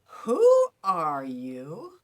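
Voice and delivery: final take for the lesson, loud and rising; incredulous. Shown as a demonstration. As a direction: ask it in loud disbelief. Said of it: "Let's try one more".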